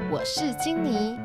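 ordinary talk, nothing marked

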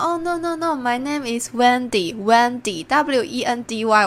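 慢慢纠正名字. Retold or imitated. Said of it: "我就跟他说".

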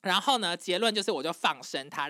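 normal talking voice

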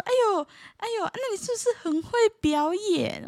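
rapid high "哎呦，哎呦" then a coaxing question; fast, high-pitched busybody relative; gushing relative. Retold or imitated. Distant relative meeting the student at New Year, fast high gushing voice.

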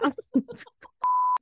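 very high loud laugh; high shriek of laughter. Real, not acted. Laughing at the kids' shrimp funeral story. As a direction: shriek with delight.

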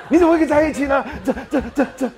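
high, loud, laughing fake shock; stammering mock astonishment through laughter; hammed-up surprise. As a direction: overact the stunned teacher. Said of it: "我很喜欢演这种的".

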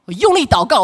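usual speaking voice

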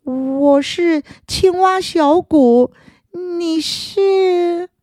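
scared, squeezed-out little voice; strangled voice. Acted. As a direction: force the words out in fear. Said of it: "好不容易才从嘴里挤出一句话来".

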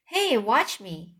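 ordinary delivery

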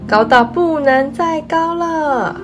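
excited. Acted. Voiced father outdoing the child.

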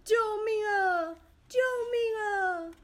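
loud, high desperate cry for help; shouting for help. A narrator's reading. Trapped in a pit, calling to passing footsteps, loud desperate shout. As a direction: yell up out of the pit, close to crying. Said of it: "他连忙朝上头大喊".

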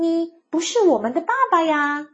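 high-pitched little bunny line; bunny children. A narrator's reading. Bunny children to the mole, high-voiced protest. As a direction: pipe up as the bunny kids. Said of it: "兔子宝宝们也异口同声地说".

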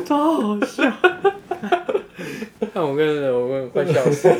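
high, loud words broken by laughter; cracking up while telling it; laughing. Real, not acted. Friend recalling the funny story, laughing hard.

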